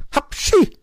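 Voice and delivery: very high, loud "hapschi" sneeze; acted sneeze. A narrator's reading. Cat smelling fox, explosive sneeze. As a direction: burst into a storybook sneeze. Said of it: "nieste er".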